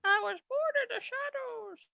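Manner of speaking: very high baby-voiced villain line; baby Bane voice. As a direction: say it like a tiny menacing baby. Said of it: "Bane in a baby Alden's voice".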